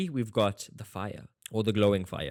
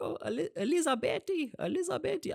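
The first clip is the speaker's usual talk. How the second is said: much higher, wailing Elizabethy; mock emotional TikTok fan; faster than usual. Imitating the weepy TikTok fan over Eben Etzebeth. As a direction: ham up a sobbing fan's lament. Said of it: "the dude on TikTok who was, like, super emotional".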